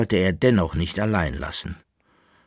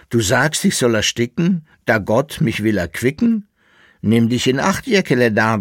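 raised, louder character voice; dramatic warning; 5 semitones higher, 6 dB louder than usual. Acted. Koppelbär warns Jäckele-Narr about evil wishes, agitated and emphatic. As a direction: warn him with emphatic swinging lines.

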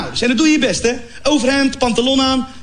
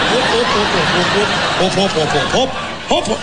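rhythmic mashing noises; 5 semitones lower than usual. Retold or imitated. Imitating mashing food.

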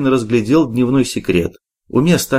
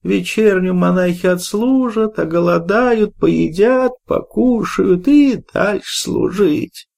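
put-on high, slow toothless old woman mumble; gumming soft food; 8 semitones higher, slower than usual. A narrator's reading. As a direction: grumble it gumming like a toothless crone. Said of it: "прошамкала недовольная Шура". (шамкающая старуха, высоким голосом)

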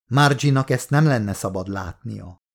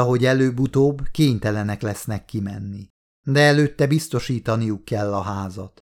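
usual speaking voice

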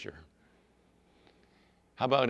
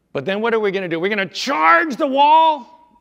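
fired-up warrior; 11 semitones higher, 13 dB louder than usual. Acted. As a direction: shout it up like a charge. Warriors eager to attack Jericho.

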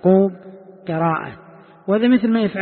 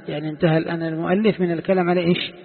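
usual speaking voice

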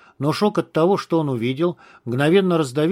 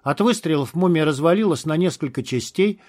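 ordinary delivery

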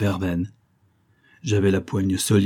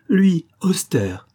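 cri aigu et lent, plus fort; elle s'exclame, incrédule, en étirant les mots; s'écrier, stupéfaite; 9 semitones higher, 4 dB louder, much slower than usual. A narrator's reading. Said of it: "s'écria-t-elle". («Lui, austère!» lancé plus haut, lentement)

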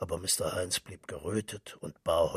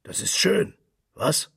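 high, loud exclamation with a tag question; loud calling out; 8 semitones higher, 9 dB louder than usual. A narrator's reading. Mister Henchy prods Crofton about the poem, calling out high and loud. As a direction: exclaim it loudly, pressing for agreement. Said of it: "rief Mister Henchy".

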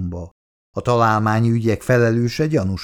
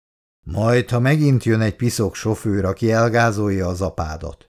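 ordinary delivery

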